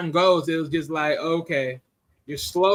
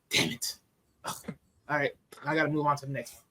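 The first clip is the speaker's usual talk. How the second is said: muttered frustration; 5 semitones lower, 9 dB quieter, faster than usual. Retold or imitated. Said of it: "And she's like".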